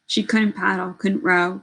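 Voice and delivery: ordinary delivery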